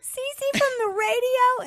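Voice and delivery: put-on high, slow, loud child voice; little kid. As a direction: repeat it like a small kid to someone who can't hear. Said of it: "And he goes".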